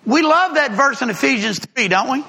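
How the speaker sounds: ordinary talk, nothing marked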